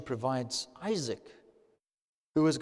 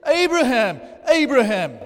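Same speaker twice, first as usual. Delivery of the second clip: loud, higher call from heaven; 8 semitones higher, 8 dB louder than usual. A narrator's reading. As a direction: call his name across a distance, louder. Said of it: "called to him from heaven".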